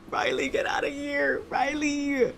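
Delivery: high urgent yell of warning; shouting at the screen. Real, not acted. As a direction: scream a warning at him. Yelling at the screen.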